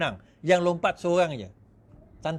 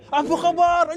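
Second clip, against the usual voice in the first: mock sweet greeting; 10 semitones higher, 5 dB louder, slower than usual. Mocking candidate greeting voters.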